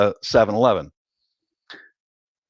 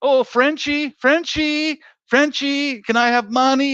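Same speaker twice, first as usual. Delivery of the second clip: much higher, sing-song wheedling voice; wheedling ladyboy; 14 semitones higher than usual. Retold or imitated. Ladyboy begging the Frenchie for money, much higher wheedling voice. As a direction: beg sweetly, way up high. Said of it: "every night was".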